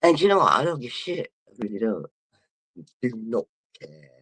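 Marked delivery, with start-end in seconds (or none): none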